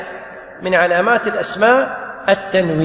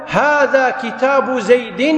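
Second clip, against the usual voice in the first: slow, with "زيد" ending in a held "-in"; drawn-out example; 3 semitones higher, much slower than usual. Shown as a demonstration. Teacher gives a grammar example, slowly holding the ending of "زيد". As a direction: stretch the nasal n closing "زيد".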